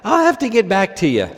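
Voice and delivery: hammed-up Irish pub caller declaring war; attempted Irish accent. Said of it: "needs an Irish accent".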